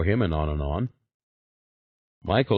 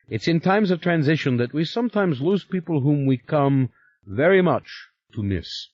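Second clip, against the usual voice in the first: stern Stoloff; 6 semitones higher than usual. Acted. Stoloff lecturing Yuri on the order's obedience, firm. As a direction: remind him who's in charge.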